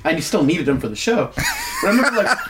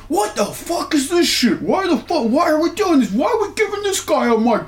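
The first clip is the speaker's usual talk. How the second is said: high-pitched mock rant, much higher voice; imitated screaming rant; 13 semitones higher than usual. Imitating a colleague ranting about a promo, losing his mind. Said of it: "he's losing his mind".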